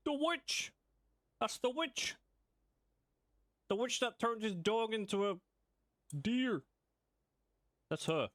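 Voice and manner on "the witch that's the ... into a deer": high excited recognition. Real, not acted. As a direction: blurt it out, thrilled. Recognizes the witch on screen.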